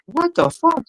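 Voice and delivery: high, startled what the fuck; hammed-up disbelief; shocked exclamation. Hitchhiker shocked in joke.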